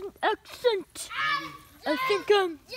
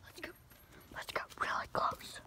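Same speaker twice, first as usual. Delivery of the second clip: breathy stalking whisper; 16 dB quieter than usual. Acted. Explorer creeping closer to the creature, whispering.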